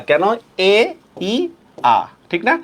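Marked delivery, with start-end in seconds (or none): 0.7-2.0 এ, ই, আ as three isolated sounds with pauses; separate, spaced vowels এ, ই, আ. Shown as a demonstration. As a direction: count out the vowels এ... ই... আ one at a time. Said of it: "আয়েশা শব্দের মধ্যে তিনটা".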